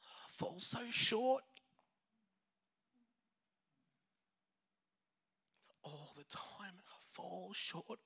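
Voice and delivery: choked up, barely getting the words out; high, cracking voice fading to a whisper; emotional. Real, not acted. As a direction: voice breaking into a tearful whisper. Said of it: "I'm an emotional guy".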